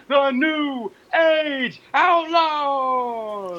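rising ring announcer call, slowing down; Road Dogg intro. Retold or imitated. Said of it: "such as the Road Dogs".

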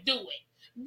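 usual speaking voice

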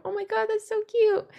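high, delighted gush; a fan swooning over a cute moment; gushing squeal. Retold or imitated. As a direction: gush over it like you can't stand the cuteness.

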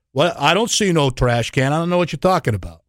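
quick, mock New York security guard; rushed, badly faked New York accent. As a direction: play dumb in a hammed-up New York accent. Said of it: "That's my bad New York accent".

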